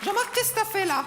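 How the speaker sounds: high, squeaky-pitched swapped voice; ridiculous puppet voice. Acted. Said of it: "T'as une voix ridicule".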